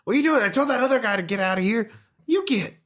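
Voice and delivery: angry hermit. Acted. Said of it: "He's like".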